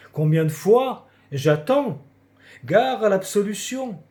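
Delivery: loud, insistent confessor with rising question; impatient priest. A narrator's reading. Priest repeating his question to a silent boy, impatient warning. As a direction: press for an answer, then warn.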